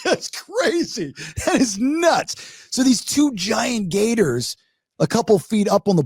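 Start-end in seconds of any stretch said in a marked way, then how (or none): 0.0-2.7 high, slow, drawn-out amazement; amazed disbelief. Retold or imitated. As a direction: stretch it out in awe, way up high. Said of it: "I'm like".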